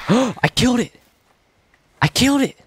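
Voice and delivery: real thrill of the kill; excited yell. Real, not acted. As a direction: shriek with disbelieving joy. Kills a raptor in game.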